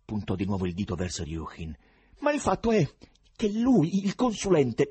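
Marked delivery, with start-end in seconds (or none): 2.2-4.9 voce acuta e concitata; poeta agitato. A narrator's reading. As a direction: insisti con foga, acuto e forte. Ivan accusa il consulente. (concitato, voce acuta)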